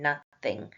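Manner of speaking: "nothing" broken into two separate parts; exaggerated syllables. Shown as a demonstration. Teacher counting syllables for students, exaggerated pronunciation. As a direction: split "nothing" clearly after its first syllable. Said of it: "I just exaggerated it there".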